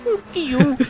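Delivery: squeaky mock taunt. Echoing the driver quote.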